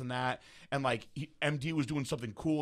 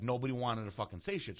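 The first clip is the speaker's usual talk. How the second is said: ordinary talk, nothing marked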